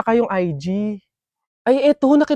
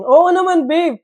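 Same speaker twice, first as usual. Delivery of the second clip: ordinary talk, nothing marked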